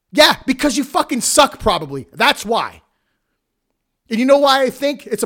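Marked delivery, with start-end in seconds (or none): 0.1-1.6 sudden loud, higher blast of contempt; scornful yell; angry outburst. Real, not acted. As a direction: spit it out at him, raised voice. Ranting at a whining wrestler.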